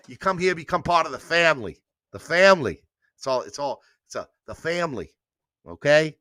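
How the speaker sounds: put-on amateur New York accent, edging into Jersey; badly faked New York-Jersey accent; New Yorker accent. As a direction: play a hammed-up New York family host. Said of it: "What I was trying to do as a New Yorker".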